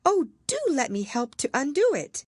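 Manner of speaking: put-on anxious helpful girl; eager Alice. A narrator's reading. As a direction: rush to help. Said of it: "looking anxiously about her".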